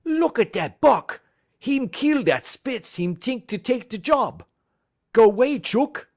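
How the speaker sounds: an amused French-Canadian driver shooing a dog; loud French-Canadian cry; crying out, French-Canadian accent. A narrator's reading. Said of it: "he cried".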